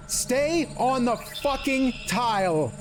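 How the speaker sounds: high, slow, emphatic order; frustrated. Real, not acted. Gamer ordering an enemy onto a tile, frustrated. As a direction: drag out each word in frustration. Fed up with moving enemies.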